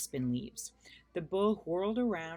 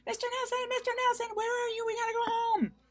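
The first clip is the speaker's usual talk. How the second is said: very high, loud calling shriek; 6 dB louder than usual. A narrator's reading. Pippi shrieking for her lost monkey. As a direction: call across the woods. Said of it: "shrieking at the top of her voice".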